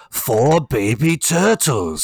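slow, drawn-out put-on accented reading; Kevin Clash line reading. Host quoting the line stuck in his head, slow mock accent. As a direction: stretch each word out in a mock accent, very slowly. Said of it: "Kevin Clash in that, uh, slightly offensive accent".

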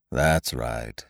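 low, soft, dragging donkey voice; gloomy Eeyore. A narrator's reading. Eeyore doubts Pooh's good morning, low and mournful. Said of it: "said Eeyore".

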